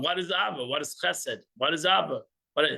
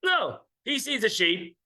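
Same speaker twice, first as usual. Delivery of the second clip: usual speaking voice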